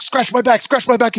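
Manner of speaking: high, insistent repeated plea; urgent radio call. Retold or imitated. Truck commander calling on the net, high and urgent. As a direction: imitate a frantic commander on the net. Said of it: "T C's on the net".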